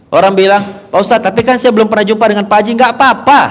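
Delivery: high, loud fervent preaching; preaching at full force. Acted. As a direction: drive the point home to the congregation.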